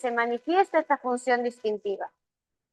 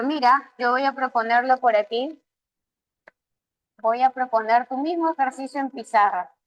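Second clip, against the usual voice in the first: ordinary talk, nothing marked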